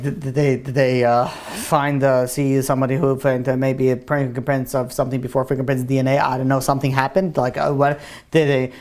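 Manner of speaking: low, stammering, rambling questions; nervous mumbling. Acted. Student fishing about the robbery investigation, mumbling nervously. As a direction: mumble and stumble over guilty questions. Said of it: "and he starts mumbling".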